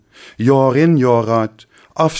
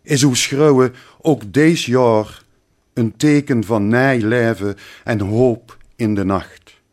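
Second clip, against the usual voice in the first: ordinary talk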